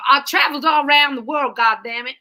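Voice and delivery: loud, brash fake American shout; hammed-up American accent, shouted; bad American accent. Shown as a demonstration. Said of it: "my accent's so bad as well".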